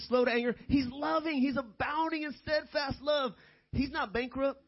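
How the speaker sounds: high-pitched, urgent pulpit exhortation; emphatic preaching. Real, not acted. Preacher warns congregation about presuming on grace, emphatic high preaching.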